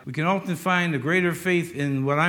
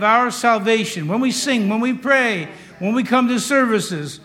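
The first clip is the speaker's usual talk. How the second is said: raised, slowed pleading prayer with shouted cries to God; fervent prayer; 4 semitones higher, 5 dB louder, slower than usual. Acted. Pastor praying over the church, fervent and raised. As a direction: pray it out hard, reverently but forcefully. Preacher leads closing prayer.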